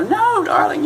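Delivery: very high, cooing "No, darling"; mock-sweet loving wife. Wife soothing her husband before a party, cooing high. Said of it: "he thinks the wife's gonna go".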